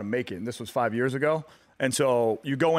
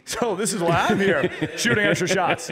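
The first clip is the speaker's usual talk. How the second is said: chuckling out the words, a bit louder; laughing through it; 7 semitones higher, 5 dB louder than usual. Retold or imitated. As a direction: let the laugh carry the line. Retelling his own joking reply.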